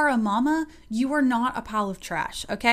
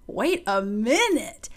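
very slow, drawn-out husband voice; hammed-up slow double take with swooping pitch; husband's amazed double take; much slower than usual. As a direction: stretch it out slowly as a stunned, impressed husband. Imagined husband's second, bigger double take.

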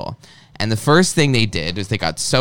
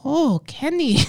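put-on high, hesitant try at a Chinese-accent call of Kenny; halting mock Chinese accent; slower than usual. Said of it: "I can't do it. Ha-, maybe".

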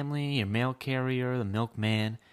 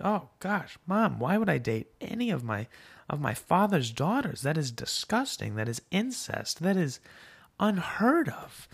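higher-pitched mock outrage; acted disgust, slowing on the final words; disgusted disbelief; 6 semitones higher than usual. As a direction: protest in appalled disbelief, lightly hammed-up. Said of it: "makes a face of disgust and".

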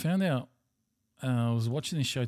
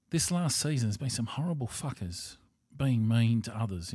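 usual speaking voice

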